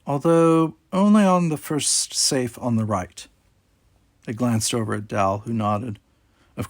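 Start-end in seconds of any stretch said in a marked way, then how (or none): 0.1-3.3 slow, pleasant, otherworldly voice; slow, calm and agreeable; pleasant robot voice. A narrator's reading. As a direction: answer helpfully, unhurried. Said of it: "Zero said pleasantly".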